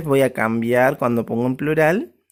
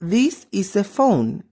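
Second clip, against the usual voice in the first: slow model sentence; 4 semitones higher, slower than usual. Shown as a demonstration. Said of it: "Yo puedo decir".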